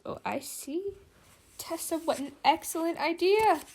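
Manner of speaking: light dawning, thrilled; delighted realization. A narrator's reading. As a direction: Charlotte thrilled by Tessa's idea.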